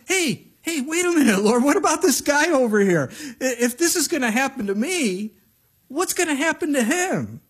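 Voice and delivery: high, loud indignant protest; Peter. Retold or imitated. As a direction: butt in with a hammed-up objection.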